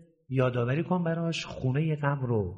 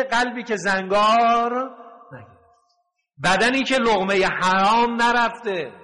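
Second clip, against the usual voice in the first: fervent preaching; 5 semitones higher, 4 dB louder than usual. Real, not acted.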